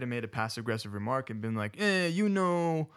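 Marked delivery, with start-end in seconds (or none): 1.8-2.9 put-on high, drawn-out vaguely Italian accent; unplaceable put-on accent. Churchgoer making a passive-aggressive remark, in a muddled put-on accent. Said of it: "I don't know why I'm talking in that accent".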